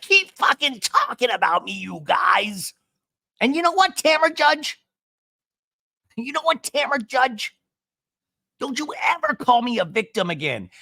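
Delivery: high, loud hammed-up tirade; shrieky imitated outrage; mock furious yelling. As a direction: imitate someone shouting back at gossiping friends. Acting out Shannon yelling at them.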